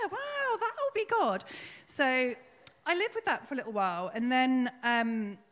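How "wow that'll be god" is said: high, slow awed imitation; drawn-out wondering agreement; lightly voiced friend, amazed; Cliff's reply.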